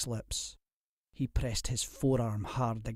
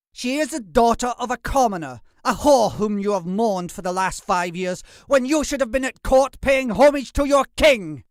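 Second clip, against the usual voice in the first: higher, louder scornful rant; contemptuous outburst; 11 semitones higher, 8 dB louder than usual. A narrator's reading. Captive lord sneering at his captor, loud and scornful. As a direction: spit the insult at him with contempt. Defiant noble spits insults.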